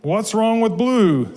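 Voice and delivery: high, drawn-out protest; indignant mouse. A narrator's reading. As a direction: challenge it like an affronted storybook mouse. Mouse challenges squirrel's dislikes in story.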